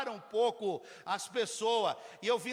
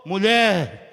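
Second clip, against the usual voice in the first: loud, ringing sermon delivery; full-force preaching; 3 semitones higher, 12 dB louder, slower than usual. Acted. Pastor exhorting the congregation, loud preaching. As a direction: preach it out to the whole church.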